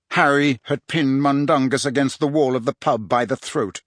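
usual speaking voice

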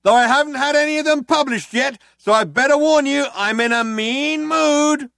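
high and loud, slowing on mean mood; other cop, mock menace. A narrator's reading. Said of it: "chimed in the other cop".

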